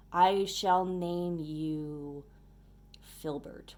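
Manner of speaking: slow, stately pronouncement; mock-solemn naming. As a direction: bestow a name with mock ceremony. Mock-solemn naming of stink bug.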